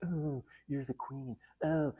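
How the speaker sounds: mock a guy fawning over a queen; hammed-up sappy pushover; groveling apologetic guy. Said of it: "a guy to go".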